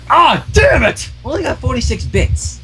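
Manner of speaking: high, slow annoyed groan; frustrated curse. A narrator's reading. Teacher finds herself short at checkout, frustrated. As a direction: curse at the empty wallet. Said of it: "short of money".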